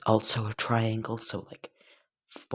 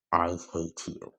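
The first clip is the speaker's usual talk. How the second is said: deadpan; 2 semitones lower than usual. Acted. Izuku answering Bakugo's boast, deadpan. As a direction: say it dead flat, no emotion. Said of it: "Izuku would just deadpan and say that".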